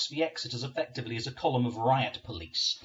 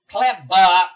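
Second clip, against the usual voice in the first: loud, high gloating cry; 7 semitones higher, 18 dB louder than usual. Acted. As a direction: crow it in triumph. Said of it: "Matron gloats at me".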